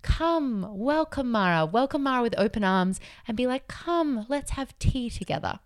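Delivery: soft, gentle high welcome; welcoming Buddha. Retold or imitated. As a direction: invite the demon in kindly. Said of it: "say to Mara".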